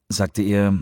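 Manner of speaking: ordinary delivery, nothing marked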